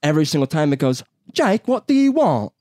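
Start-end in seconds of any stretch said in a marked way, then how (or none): 1.3-2.5 high, loud Siri in goofy Australian; mock an Australian voice assistant, pitched way up; goofball Australian accent. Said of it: "goofball Australia accent".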